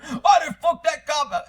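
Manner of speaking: high, loud imitation of tough talk; mock blustering commenter. Mocking commenters who talk big about cops, loud bluster. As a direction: puff it up into a mock shouted boast. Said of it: "I get people in the comments here".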